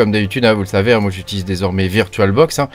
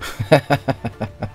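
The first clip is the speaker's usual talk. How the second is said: soft, higher-pitched chuckle; laugh at own mistake; 4 semitones higher, 5 dB quieter than usual. Real, not acted. Presenter grabbed the wrong file, soft self-mocking laugh. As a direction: chuckle at your own blunder.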